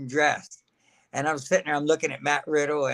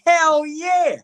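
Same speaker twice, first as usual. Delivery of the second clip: much higher, loud burst of joy; excited yell; 12 semitones higher, 7 dB louder than usual. Retold or imitated. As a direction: shout it like grabbing the chance. Retold eager acceptance of title.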